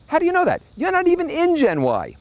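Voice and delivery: high, sarcastic disbelief; incredulous scoff. Real, not acted. Telling the pundit he's not even Gen Y, high incredulous. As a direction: pitch it up, mocking their certainty. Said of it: "mocking the oracle".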